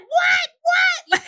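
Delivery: very high shouting with laughter and tears; shouted what. Retold or imitated. Mom hearing the news in the car, shouting in joyful disbelief. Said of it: "My mom's like".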